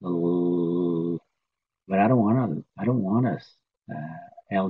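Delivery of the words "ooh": ordinary talk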